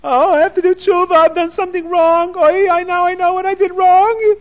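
high, loud crying voice; rising wail of remorse; tearful confession. Acted. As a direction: sob it out high and full of regret. Rich man repents, crying.

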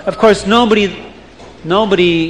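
ordinary delivery, nothing marked

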